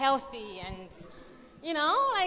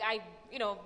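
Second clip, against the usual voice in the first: ordinary talk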